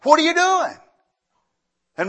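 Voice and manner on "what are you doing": high, loud challenge across the yard; a suspicious neighbor shouting at a stranger leaving with tools; neighbor yelling. Retold or imitated. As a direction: call out sharply at a stranger. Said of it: "he yells at me and says".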